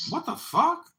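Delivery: high-pitched incredulous outburst; shocked, rising squawk of a question; startled disbelief. Real, not acted. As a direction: blurt it out, voice leaping up in disbelief. Reacts to odd book title.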